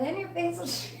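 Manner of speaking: song breaks into laughter; laughing. Real, not acted. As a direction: crack up mid-line.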